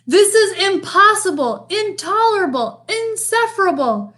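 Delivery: high-pitched, mounting exasperation; angry disbelief. A narrator's reading. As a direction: cry out in outraged disbelief. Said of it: "shaking with anger and disbelief".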